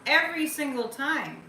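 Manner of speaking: "Every" punched loud and high; emphatic stress. Shown as a demonstration. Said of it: "I'm emphasizing".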